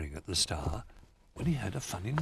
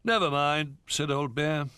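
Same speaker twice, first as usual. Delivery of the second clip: reassuring; 7 semitones higher than usual. A narrator's reading. Old Bear reassures.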